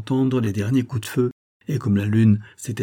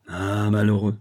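stammering. A narrator's reading. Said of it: "balbutia le capitaine".

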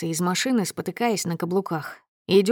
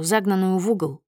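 usual speaking voice

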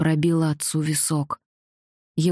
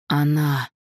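rumbling; 3 semitones lower than usual. A narrator's reading. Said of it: "пророкотал он".

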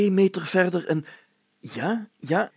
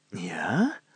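a hushed, breathless whisper; 9 semitones lower, 3 dB quieter than usual. A narrator's reading. Mayor whispering as he finds something, hushed. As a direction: whisper it, holding your breath. Tense discovery of the keyhole.

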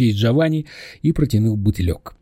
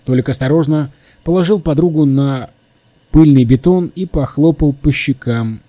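ordinary delivery, nothing marked

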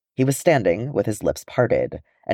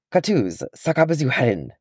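shouted; 5 semitones higher than usual. A narrator's reading. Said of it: "Bifur shouted".

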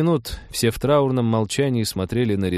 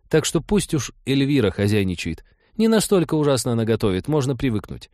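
higher-pitched woman arguing; insistent, animated female character; firm Trixie; 7 semitones higher, 3 dB louder than usual. Acted. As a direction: argue firmly in a higher voice. Trixie argues back.

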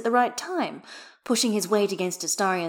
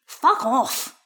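loud, higher grumpy outburst; grousing; 6 semitones higher, 6 dB louder than usual. A narrator's reading. Skater grouses at his laughing partner, loudly. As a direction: tell them off, loud and grousing. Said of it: "Gale grouses at his knees".

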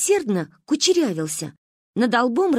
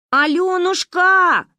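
slow, loud, high call; 10 semitones higher, 7 dB louder, much slower than usual. Acted. Girls call out to the girl together. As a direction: call her name across the street. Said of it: "позвали мы".

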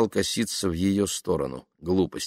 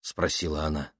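plain reading voice

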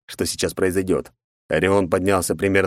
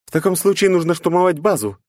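female character voice; 12 semitones higher, 4 dB louder than usual. Acted. Knight voiced.

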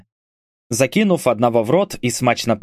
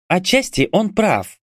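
slow, higher-pitched grudging remark; mildly displeased; 8 semitones higher than usual. A narrator's reading. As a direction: concede it reluctantly, drawing it out. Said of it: "недовольно подметила Катя". (слегка недовольно, медленно и выше тоном)

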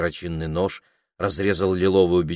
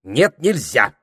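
a touch of a snapped no; mildly sharp parrot refusing; curt refusal; 6 semitones higher, 4 dB louder, slower than usual. Acted. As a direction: cut her off short. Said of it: "отрезал попугай".

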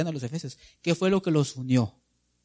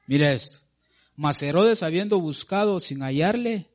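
ordinary delivery

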